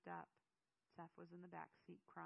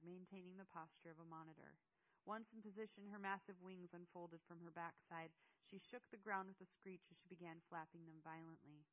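ordinary delivery, nothing marked

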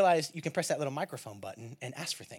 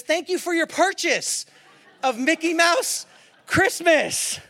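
loud, high, slow announcement; mock-horrified readout; 12 semitones higher, 9 dB louder, slower than usual. Dad reads a surprise purchase email aloud, loud and appalled. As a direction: spell out the bad news piece by piece.

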